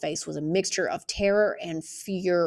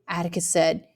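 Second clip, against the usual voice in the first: ordinary delivery, nothing marked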